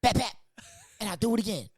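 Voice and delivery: lightly voiced cartoon villain threat; comet talking. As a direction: taunt like a comet with attitude. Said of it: "And Haley's Comet's like".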